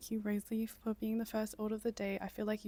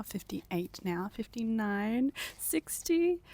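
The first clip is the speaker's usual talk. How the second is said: rising excitement; 3 semitones higher, 7 dB louder, slower than usual. Real, not acted. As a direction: count up, getting more thrilled. Counting climbing visitor numbers.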